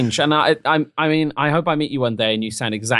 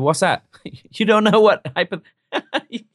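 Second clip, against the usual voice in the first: mock smug friend; 6 semitones higher, 4 dB louder than usual. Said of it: "then be like".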